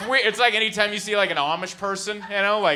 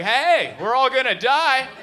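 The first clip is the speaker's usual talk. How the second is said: retold scream; 7 semitones higher, 6 dB louder, slower than usual. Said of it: "I was screaming things like".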